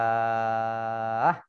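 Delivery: "zakah" with a long held "kaa" ending in h; final syllable of "zakah" drawn out, closed on h; stopped on ha. Shown as a demonstration. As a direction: chant "zakah", stretching the last vowel. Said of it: "dibaca ha. Maka menjadi".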